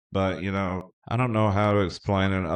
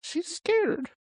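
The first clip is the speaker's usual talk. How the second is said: teasing voice. Pretend. Teasing his silent guest.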